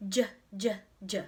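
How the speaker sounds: J, j, j with voice almost off; whispered J sound. Shown as a demonstration. Teacher modelling the jumping sound, whispered. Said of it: "or the jumping sound".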